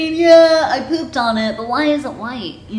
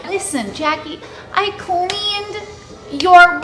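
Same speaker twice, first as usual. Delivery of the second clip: usual speaking voice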